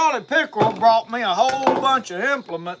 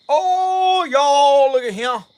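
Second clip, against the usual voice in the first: excited; 6 semitones higher, 8 dB louder, slower than usual. Real, not acted. Proudly shows the meat.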